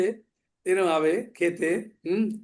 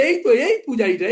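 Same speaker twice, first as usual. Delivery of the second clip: excited recognition; 4 semitones higher, 4 dB louder than usual. Retold or imitated. Devotee spots the priest.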